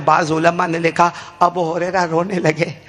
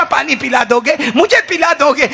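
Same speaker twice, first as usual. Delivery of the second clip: fervent plea; 7 semitones higher than usual. Acted. Abu Hurairah begs the Prophet to give water on Judgement Day, fervently. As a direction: plead with rising fervour.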